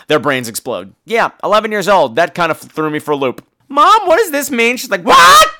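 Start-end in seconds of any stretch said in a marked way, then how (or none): 3.7-4.8 put-on high-pitched kid's question; child self. Eleven-year-old asking mom about a book, innocent. 5.0-5.5 very high, loud squawk; shocked mother. Retold or imitated. Mother hearing the child's question, shocked.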